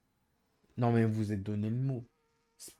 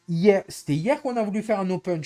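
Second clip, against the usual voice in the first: insistent louder; 7 semitones higher, 7 dB louder than usual. Real, not acted. Insisting on yesterday.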